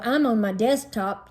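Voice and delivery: plain reading voice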